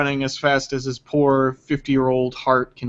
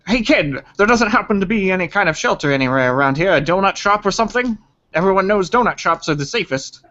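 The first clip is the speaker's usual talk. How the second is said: put-on higher-pitched character voice calling to a kid; Harvey; 5 semitones higher than usual. Man asking a local kid for shelter, higher acted voice. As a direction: ask a local in an acted, raised voice. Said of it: "Harvey is looking at what he assumes to be a local".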